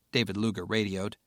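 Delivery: ordinary delivery, nothing marked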